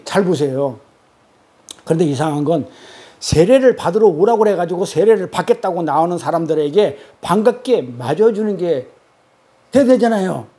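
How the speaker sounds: ordinary talk, nothing marked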